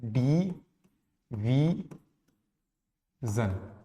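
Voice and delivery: Division in three slow beats, "di... vi... zan"; split into syllables. Shown as a demonstration. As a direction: pause long after each syllable of division. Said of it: "ये तीन syllable का बनेगा". (Division के तीनों syllable अलग-अलग, बहुत धीरे)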